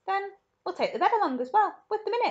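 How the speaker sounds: high, eager storybook Wonka; excited Wonka. Acted. Wonka cheerfully tells Grandpa Joe, eager and high.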